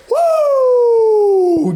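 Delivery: big high, loud woo to kick off the show; excited high whoop opening the episode; hype yell. Real, not acted. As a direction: whoop like the crowd just went wild. Hype shout after clap count.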